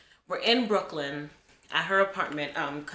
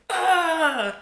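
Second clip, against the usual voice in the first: high, loud pained yelp; retold cry of pain; 11 semitones higher, 8 dB louder than usual. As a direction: yelp like a hurt kid. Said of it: "I was like".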